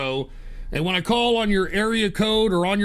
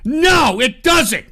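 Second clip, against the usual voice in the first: loud, higher snapped no; indignant yell; angry shout; 6 semitones higher, 7 dB louder, much slower than usual. Real, not acted. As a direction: shout the answer down. Answers own question angrily.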